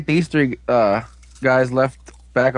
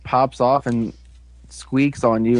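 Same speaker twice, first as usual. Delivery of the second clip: ordinary delivery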